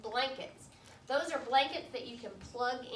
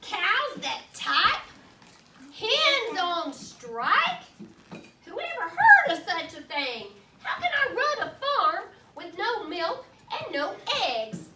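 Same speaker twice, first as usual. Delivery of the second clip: furious outburst; 5 semitones higher, 7 dB louder than usual. Acted. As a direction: rant at the absurd farm.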